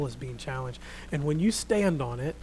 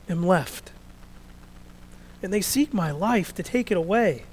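louder and higher, pleading lament; impassioned Elijah; 5 semitones higher, 11 dB louder than usual. Acted. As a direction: pour out Elijah's complaint with force. Elijah's complaint, first reading.

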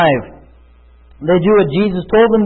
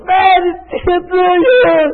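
pitched-up hammed-up moan; mock complaint of pain; 9 semitones higher, slower than usual. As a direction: play the man nailed up, pointing to his pain. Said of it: "The natural man goes".

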